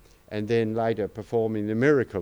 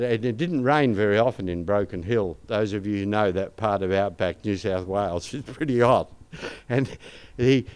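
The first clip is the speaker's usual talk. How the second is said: ordinary delivery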